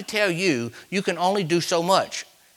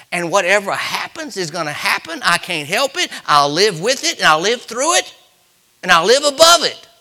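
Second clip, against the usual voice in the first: preaching crescendo; 5 semitones higher, 6 dB louder than usual. Real, not acted. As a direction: build higher and louder with each phrase. Preaching builds to a climax.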